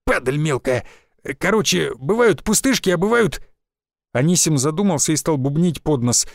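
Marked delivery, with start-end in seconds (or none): 0.0-0.9 just swallowed a bug. Acted. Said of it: "Лесник закашлялся и сплюнул мошку". 1.4-3.4 lightly voiced old village forester; a folksy old woodsman, a touch rough.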